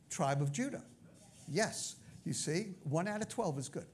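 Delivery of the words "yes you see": ordinary talk, nothing marked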